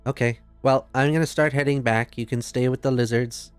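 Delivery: ordinary talk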